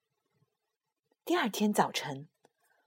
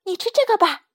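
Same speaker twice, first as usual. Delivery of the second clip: put-on high, eager child voice, proud; a small child showing off a gift; little dinosaur child; 11 semitones higher, 8 dB louder than usual. Little dinosaur offering fruit to papa, high and proud.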